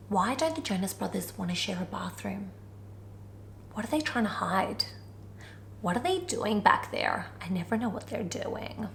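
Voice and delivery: low, hushed conspiracy theorist. Acted. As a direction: muse darkly under your breath. Mock conspiracy musing.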